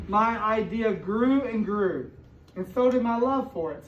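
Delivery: expressive storybook read-aloud to a class; reading to children. A narrator's reading. Teacher reading a picture book to her class, storybook voice.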